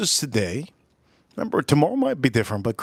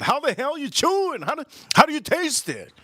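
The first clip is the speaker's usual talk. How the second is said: much higher, incredulous questions; can't believe anyone drinks like that; mock disbelief; 12 semitones higher than usual. Streamer mocking whiskey drinkers who skip air, mock disbelief.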